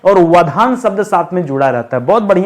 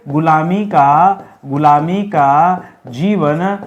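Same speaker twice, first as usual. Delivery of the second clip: slow dictation; 3 semitones lower, slower than usual. Shown as a demonstration. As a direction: read it out word by word for notes. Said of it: "क्या लिखिए?". (धीरे-धीरे बोलकर लिखवाना)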